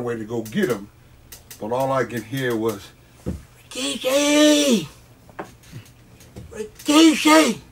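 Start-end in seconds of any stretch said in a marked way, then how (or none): 3.7-7.6 put-on high, slow, hollering old-lady voice calling across the arena; southern grandma shouting from the crowd. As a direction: yell like a big mama from the back rows. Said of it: "Had that southern accent. Sound like an old lady".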